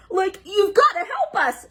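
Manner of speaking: high pleading holler; Shaggy hollering. Acted. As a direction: beg for help loudly. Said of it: "Shaggy hollered".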